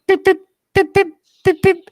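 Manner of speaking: high, loud electronic beeps; imitated camera beep. Camera refusing to record, high imitated warning beeps. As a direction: beep like an action camera in short, piercing pairs. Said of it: "it'd be like".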